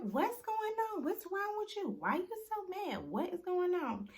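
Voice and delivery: imitating the girlfriend's high innocent questions; mock innocent girlfriend. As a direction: play her as a wide-eyed girl protesting she did nothing. Girlfriend voiced confused in recap.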